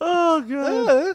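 laugh crying. Real, not acted.

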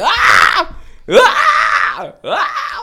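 loud, high drawn-out anime scream; imitated Goku yell. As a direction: scream like a cartoon fighter being slammed. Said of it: "Goku just yelling".

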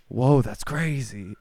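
normal talking voice

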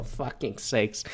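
low, slow, under-the-breath curse; soft, slow, fed-up aside; exasperated mutter. Retold or imitated. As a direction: mutter it to yourself in disbelief.

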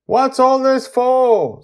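high, loud, slow call upward; shouting up. Acted. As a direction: call up to the kids on the ship. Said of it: "Susie shouts up at them".

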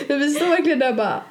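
talking through laughter, pitch up; can barely get the words out for giggling; laughing. Real, not acted. Telling a friend about the wild night, laughing.

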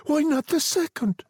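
high-pitched pleading question; desperate. A narrator's reading. Old woman arguing for the second wish, desperate. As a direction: press him desperately. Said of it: "said the old woman desperately".

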